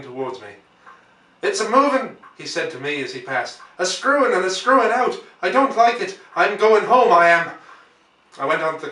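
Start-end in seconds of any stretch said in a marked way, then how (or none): 1.4-2.2 put-on high, loud frightened boy's cry; a panicked lad blurting as he runs; scared boy. A narrator's reading. Running boy calls to the narrator, loud and scared. 3.8-7.5 put-on high, loud frightened boy's cry; a panicked lad blurting as he runs; scared boy. A narrator's reading. Running boy calls to the narrator, loud and scared.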